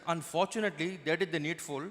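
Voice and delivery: Indian friend reporting bad news; measured Indian-accented English. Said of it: "two of my Indian friends".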